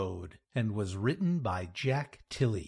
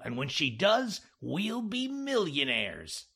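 animated higher-pitched George, coaxing his partner; scheming George; 8 semitones higher, 3 dB louder than usual. Acted. George briefing Jack on pretending to be a therapist, animated coaxing. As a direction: sell the scheme with eager, swooping pitch.

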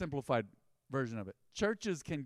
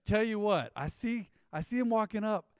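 teasing boss; 7 semitones higher, 6 dB louder than usual. Retold or imitated. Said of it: "He'd say".

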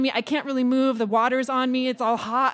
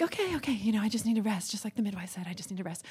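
murmured self-soothing, trailing softer; quiet self-reassurance; 5 semitones lower, 9 dB quieter, faster than usual. Retold or imitated. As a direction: talk yourself down to sleep, softly. Soothing herself in bed.

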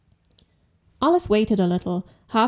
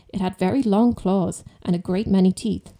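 usual speaking voice

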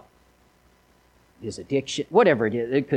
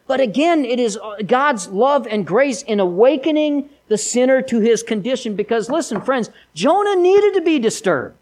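louder, much higher impassioned preaching; full-force preaching; 8 semitones higher, 5 dB louder than usual. Acted. Preacher rousing the congregation about Jonah's awakening. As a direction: drive it home from the pulpit.

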